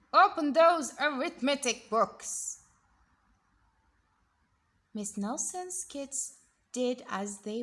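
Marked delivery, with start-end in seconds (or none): 0.1-2.6 loud, strict order; stern teacher command. Acted. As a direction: bark the order at the class. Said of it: "snapped Miss Swamp".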